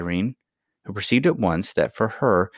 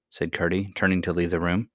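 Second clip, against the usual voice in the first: ordinary delivery, nothing marked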